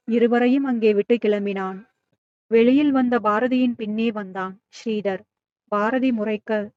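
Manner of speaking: ordinary delivery, nothing marked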